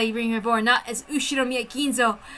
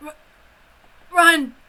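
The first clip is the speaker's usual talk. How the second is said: dying gasp; 5 semitones higher, 4 dB louder than usual. Acted. Dying man's last word.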